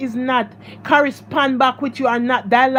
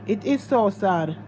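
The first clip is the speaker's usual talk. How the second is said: 1 quiet sorrow; 4 semitones lower, 6 dB quieter than usual. Real, not acted. Soft repeat of sad remark.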